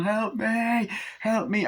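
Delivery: high, urgent wail; hammed-up panicked plea; mock cry for help. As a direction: imitate a fleeing victim begging for help. Victim runs, shouting for help.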